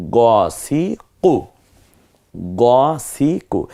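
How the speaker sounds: গসিকু, very slow, with a heavy short গ; slow correct Arabic. Shown as a demonstration. Teacher models the correct গসিকু slowly. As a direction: stretch out গসিকু, the গ kept short. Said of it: "গাসিকু না হয়ে আমরা সহি উচ্চারণ করব".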